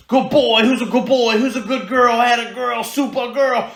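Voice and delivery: very high, loud, gushing praise; party with the dog, full intensity; over-the-top dog praise. Shown as a demonstration. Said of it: "I am channeling my inner Richard Simmons".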